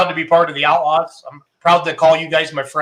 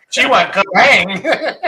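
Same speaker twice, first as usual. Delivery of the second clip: drawn-out kerrang; 5 semitones higher, much slower than usual. Retold or imitated. Imitating the tractor engine noise.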